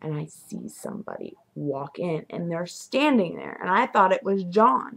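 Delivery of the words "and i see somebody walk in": usual speaking voice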